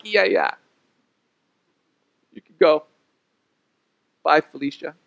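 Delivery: offhand brush-off with long pauses; mock uninterested send-off; dismissive. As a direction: wave them away, unbothered. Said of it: "that's rather dismissive".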